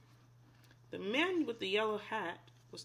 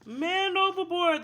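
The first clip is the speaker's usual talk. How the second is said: higher, louder call; sailors crying out; 9 semitones higher, 8 dB louder than usual. A narrator's reading. Sailors spotting George in the water, loud call. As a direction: call it out across the deck. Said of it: "the sailors cried".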